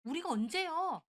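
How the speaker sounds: high, loud, drawn-out retort; indignant protest. A narrator's reading. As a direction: protest slowly, pushing voice up and out. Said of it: "신우도 막 따지더군요". (발끈해서 따지는 목소리)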